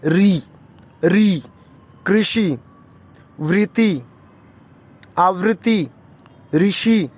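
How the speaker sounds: कृषि, वृत्ति, आवृत्ति, ऋषि said slowly, short rolled r; slow and clear, each word apart; ऋ words demo. Shown as a demonstration. Teacher drilling pupils on words with ऋ, slow and clear. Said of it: "इसको री ना बोल के हम री बोलते हैं".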